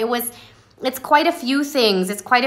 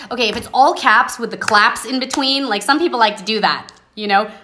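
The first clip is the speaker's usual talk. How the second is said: usual speaking voice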